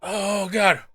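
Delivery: strained, pained from the heat; suffering through a spicy bite; mouth burning from spicy food. Real, not acted. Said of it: "it's incredibly spicy".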